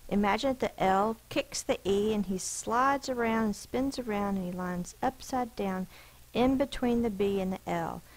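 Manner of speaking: ordinary talk, nothing marked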